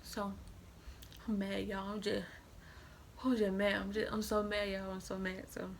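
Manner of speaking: normal talking voice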